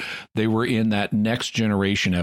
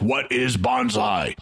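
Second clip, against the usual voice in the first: alien captain voice; 3 semitones higher, slower than usual. Retold or imitated. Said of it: "the Chalnoth captain is like".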